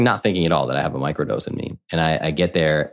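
usual speaking voice

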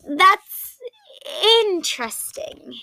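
grossed-out and trying to stay polite; disgusted. A narrator's reading. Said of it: "he replied with a frown".

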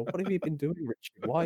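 mock wife. Wife waking to find Richard still recording, mildly mock puzzled. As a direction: ask it like a sleepy wife who is baffled to find him still up. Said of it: "wife would have waken up and go".

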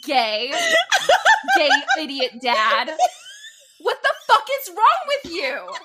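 loud mock-macho yell breaking into laughter; laughing yell. Mocking Jackson's line then yelling at him, laughing. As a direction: bellow it, half laughing.

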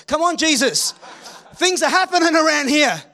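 high, loud, laughing plea; a hammed-up [name] cracking up mid-plea. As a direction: laugh through a mock-urgent plea. [name] hamming it up.

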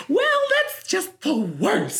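very high, drawn-out dainty voice; tooth fairy voice. Retold or imitated. Big man whining about ride delay, in a tooth fairy voice. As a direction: complain like a prissy tooth fairy, stretched high. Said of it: "the tooth fairy ends up being behind me".

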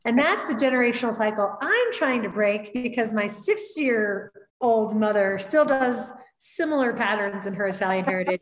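ordinary talk, nothing marked

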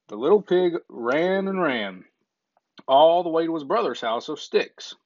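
usual speaking voice

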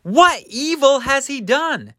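very high-pitched incredulous protest; high, loud disbelieving appeal; protesting Pilate. Acted. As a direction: plead with the mob, voice leaping up. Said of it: "And Pilate says".

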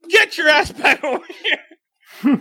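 hammed-up scolding call; retold angry order. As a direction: imitate a fed-up wife ordering him back. Wife orders husband back.

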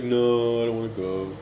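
ordinary talk, nothing marked

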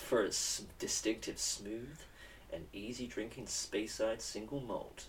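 slow, soft easy listening announcer; smooth radio ad read. Acted. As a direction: ease it out like a late-night DJ. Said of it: "Easy Listening F M".

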